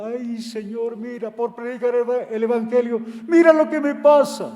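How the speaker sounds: hammed-up lament to the Lord; mock lament. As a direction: whine it like a complaining servant.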